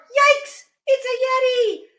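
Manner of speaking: very high cartoon fright; startled alarm. Bear meets the Yeti, high startled yelp. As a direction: yelp it in mock terror.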